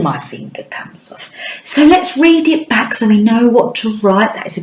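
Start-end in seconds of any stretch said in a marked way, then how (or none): 0.0-1.3 whispered aside. Shown as a demonstration. Teacher whispering a reminder to young pupils. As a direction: say it under your breath.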